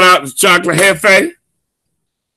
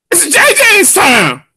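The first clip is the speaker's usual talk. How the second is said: announcer hype; 9 semitones higher, slower than usual. Real, not acted. Host announces his segment.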